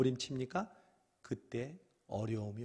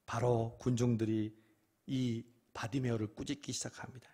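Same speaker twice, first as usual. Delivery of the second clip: ordinary delivery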